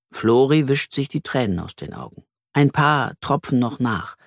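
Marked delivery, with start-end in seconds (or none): none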